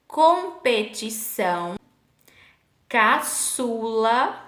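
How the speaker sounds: slow, split "competição, caçula", Ç as an S sound; teacher sounding out the S in "competição, caçula"; cedilla demo. Shown as a demonstration. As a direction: stretch "competição, caçula" syllable by syllable. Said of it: "ó o sonzinho de S".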